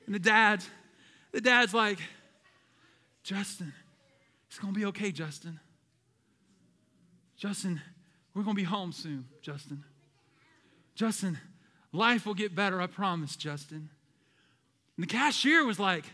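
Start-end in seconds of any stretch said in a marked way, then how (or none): 3.2-13.9 soft, patient reassurance in short pieces; gentle dad voice, names dropping away softly; soothing dad. Retold or imitated. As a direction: imitate a dad calming himself and a child, quietly. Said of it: "loving his son and taking care of him".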